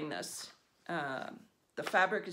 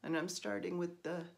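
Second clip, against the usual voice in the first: ordinary talk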